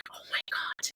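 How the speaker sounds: whispered, retold amazement; hushed disbelief. Telling of seeing her name on mastheads, hushed disbelief. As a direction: whisper it as a recalled moment of awe.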